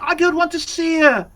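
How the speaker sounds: put-on high, loud child's yelling; possessed boy shouting at his mother; little boy yelling. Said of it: "the little boy yelling".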